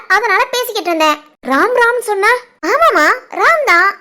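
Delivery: ordinary talk, nothing marked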